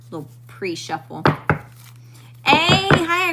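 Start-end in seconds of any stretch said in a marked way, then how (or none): 2.4-3.3 high, loud burst of joy at a viewer; excited greeting. Real, not acted. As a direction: squeal hello to a friend in chat. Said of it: "I'm getting so excited".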